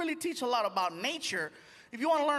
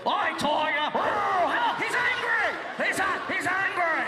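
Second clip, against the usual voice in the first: high, loud panicked cries; fluttering cries for help; 7 semitones higher, 5 dB louder, slower than usual. Retold or imitated. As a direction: yelp for help as it attacks. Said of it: "every episode".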